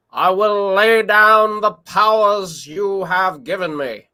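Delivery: very slow, high presidential oratory; FDR. Acted. Showing chat how the president would say it, drawn-out impression. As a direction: draw out each phrase like an old radio address. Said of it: "this is how it would sound if F D R said it".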